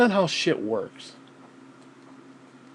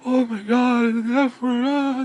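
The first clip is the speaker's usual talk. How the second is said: high, gasping mock-offended cry; mock horror; 7 semitones higher, slower than usual. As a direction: mimic a scandalised prude clutching pearls. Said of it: "Some people hear it and they're like".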